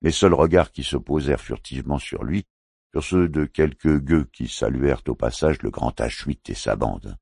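usual speaking voice

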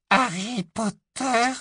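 high, slow squeak; a frightened house-elf's squeal. A narrator's reading. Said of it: "couina-t-il".

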